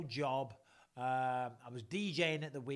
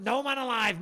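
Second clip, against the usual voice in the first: catchphrase shout; 8 semitones higher, 9 dB louder than usual. Acted. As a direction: announce it big, louder and higher. Opening his show catchphrase.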